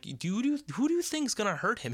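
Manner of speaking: high-pitched, disbelieving comeback; retold incredulous retort. Replaying a comeback to the boss about the Russian, incredulous. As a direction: push the pitch way up in mock disbelief.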